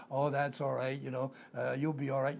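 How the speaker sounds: soft, comforting there-there; mock-gentle pat on the back; soothing reassurance. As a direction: coo it like calming a child. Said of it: "what do we tell people?".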